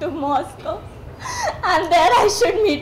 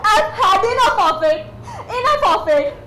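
crying. Acted. Breaking down crying.

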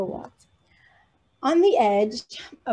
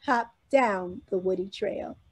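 ordinary delivery, nothing marked

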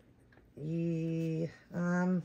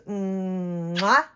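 blown kiss; 2 semitones higher, 5 dB louder than usual. Real, not acted. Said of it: "I love you guys".

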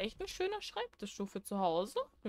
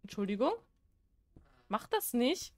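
ordinary talk, nothing marked

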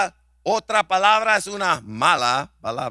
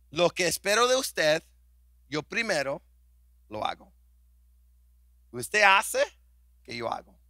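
soft, fading low voice; gentle voice of Christ; 3 semitones lower, 5 dB quieter than usual. Acted. Pastor quoting Christ to the congregation, soft and tender. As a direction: speak Christ's promise tenderly.

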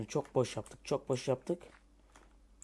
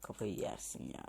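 unvoiced, softer exasperated whisper; whispered grumble; 6 semitones lower, 10 dB quieter than usual. Real, not acted. Gamer whispering in frustration at the trees. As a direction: breathe it out to himself.

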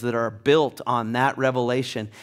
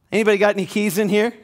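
usual speaking voice

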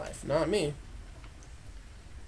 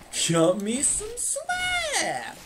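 high, very slow squeak; high squeaky voice; much slower than usual. Shown as a demonstration. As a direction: stretch it out in a tiny squeak.

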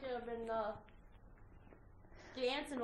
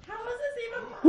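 high, loud shocked cry; mock shock; 11 semitones higher, 19 dB louder than usual. Retelling her shock at the mic, high and loud. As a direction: yelp it in disbelief. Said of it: "I was like".

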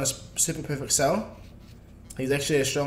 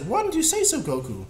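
much higher, playful put-on Bulma voice; imitating Bulma; 8 semitones higher than usual. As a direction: squeak it out like an excited Bulma. Bulma voiced in retold scene.

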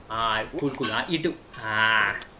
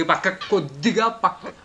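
high, loud, desperate pleading; pleading yell; 6 semitones higher, 9 dB louder than usual. Real, not acted. Gamer begging his character to shift slightly, high pleading yell. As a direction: beg the character to move just a little.

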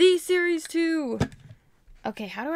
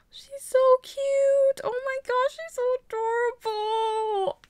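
very high, drawn-out gushing; melting over something cute; adoring squeal; 11 semitones higher, slower than usual. Real, not acted. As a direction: coo over it in a slow, high singsong.

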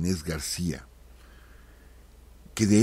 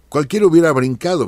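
higher and louder, bursting out; emphatic exclamation; 7 semitones higher, 10 dB louder than usual. Real, not acted. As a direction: exclaim it, as if anyone would have leapt up. Exclaims others would jump in.

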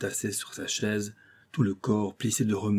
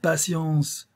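sharp retort; 7 semitones higher, 3 dB louder than usual. A narrator's reading. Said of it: "riposta le capitaine".

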